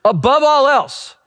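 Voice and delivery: high, drawn-out proclamation; emphatic scripture quote; proclaimed scripture. Acted. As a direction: declare the verse slowly and high. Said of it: "King Solomon writes these words".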